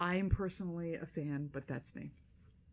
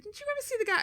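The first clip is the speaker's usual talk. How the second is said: incredulous question; faster than usual. Retold or imitated. Retells question to son.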